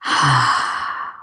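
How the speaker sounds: long unvoiced sigh out through the mouth; breathy exhale sigh. Shown as a demonstration. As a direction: let the breath out in a slow audible sigh. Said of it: "open the mouth and exhale out a sigh".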